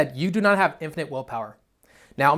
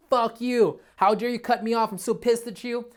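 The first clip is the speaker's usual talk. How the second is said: angry road rage; 7 semitones higher than usual. Retold or imitated. Cut off in traffic, yelling at the other driver, angry. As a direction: snap at the guy who cut you off. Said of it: "you're like".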